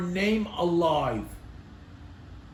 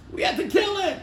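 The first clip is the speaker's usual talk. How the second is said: much higher, mock-urgent voice; mocking quote; 11 semitones higher, faster than usual. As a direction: parody the attitude in a high pitch. Mocks people who kill time.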